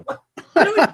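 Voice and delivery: high, loud laughing really; disbelieving, cracking up; laughing disbelief. Retold or imitated. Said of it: "I'm like".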